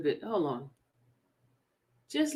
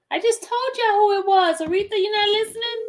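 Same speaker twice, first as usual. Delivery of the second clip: exasperated; 10 semitones higher than usual. Real, not acted. Exasperated at chat.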